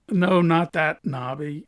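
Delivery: ordinary delivery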